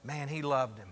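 low, soft aside to a neighbor; confiding aside. Acted. Bystander leans over to the one next to him, confiding aside. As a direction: lean in and say it privately. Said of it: "leans over and goes".

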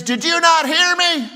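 high, loud exasperated demand; exasperated preacher shout. Retold or imitated. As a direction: bellow it at the grinning man. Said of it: "And he says".

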